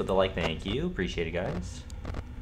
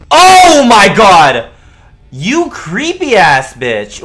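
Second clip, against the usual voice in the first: slow, high, loud exasperated yell; exasperated scream; 8 semitones higher, 12 dB louder, much slower than usual. Real, not acted. Streamer yelling at the creepy duck, exasperated scream.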